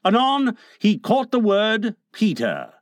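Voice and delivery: plain reading voice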